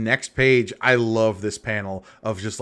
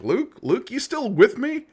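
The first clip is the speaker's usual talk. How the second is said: Han voice; 12 semitones higher than usual. Retold or imitated. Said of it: "as Han's like".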